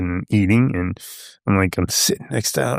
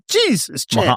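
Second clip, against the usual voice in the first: a sudden high yelp of surprise; retold startled cry at the champ; 12 semitones higher, slower than usual. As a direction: jump at the touch on your ear. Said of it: "and I was like".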